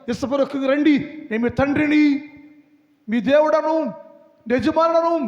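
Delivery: voice of God. Acted. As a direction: speak as God forgiving his people.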